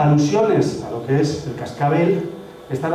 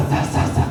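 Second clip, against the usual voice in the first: whispered tsa tsa tsa; breathy repeated tsa; tsa sound demo. Shown as a demonstration. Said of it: "del sonido tsa".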